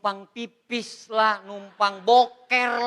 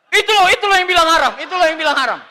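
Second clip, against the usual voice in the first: high, fast and loud, mimicking a chatty friend; mock excited gossip; 6 semitones higher, 8 dB louder, faster than usual. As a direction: blurt it out like an eager gossip. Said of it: "dia bilang". (tiruan gosip heboh, tinggi dan cepat)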